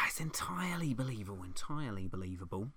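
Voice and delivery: sighed whisper. Acted. Host whispering back at co-host's joke. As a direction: breathe it out as a secret. Hushed joking reply.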